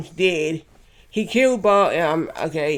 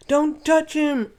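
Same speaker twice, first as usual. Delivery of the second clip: high character voice; 9 semitones higher, slower than usual. Retold or imitated. Same line redone in a character voice.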